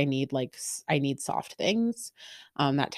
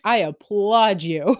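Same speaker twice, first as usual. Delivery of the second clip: mock applause; 4 semitones higher, 7 dB louder, slower than usual. Mock applause for the easy askers.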